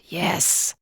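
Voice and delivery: breathy hissed yes. A narrator's reading. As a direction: hiss the word through the teeth. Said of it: "he hissed".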